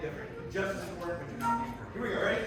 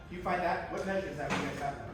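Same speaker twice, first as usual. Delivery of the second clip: ordinary talk, nothing marked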